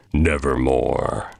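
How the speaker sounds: low, slow croak of a raven; raven's reply. A narrator's reading. The raven answers the man, low and slow. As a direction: drag it out, dark and low. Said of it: "Then the bird said".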